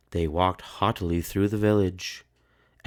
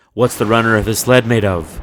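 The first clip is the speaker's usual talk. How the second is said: loud, fast demanding question; stern challenge; 4 semitones higher, 12 dB louder, faster than usual. A narrator's reading. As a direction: press them hard. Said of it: "sister testing them again".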